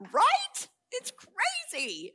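high-pitched, incredulous agreeing; excited agreement. Retold or imitated. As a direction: squeal along in shared amazement. Acts out excited agreement with interviewer.

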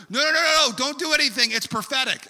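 high, loud rush of nos; urgent protest. Retold or imitated. Wife stops husband touching the ladybug, urgent loud protest. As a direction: cut in loudly, stop them acting. Acts out Julie's urgent objection.

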